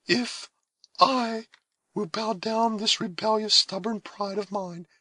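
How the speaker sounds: high, gentle, motherly voice; maternal tenderness. A narrator's reading. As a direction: comfort a younger sister. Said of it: "in an expression of tenderness that seemed maternal".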